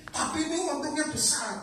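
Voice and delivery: high-pitched, wheedling objection; mock protest, pitched well up; acted protest. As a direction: argue back in a lifted, high voice. Acts out objection to God's no.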